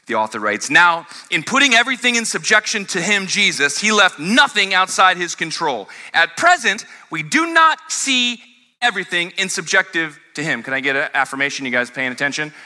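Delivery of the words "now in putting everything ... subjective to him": high, emphatic scripture reading. Acted. Preacher reads to congregation about Jesus' rule, emphatic scripture reading. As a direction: read the verse out with weighty, measured emphasis.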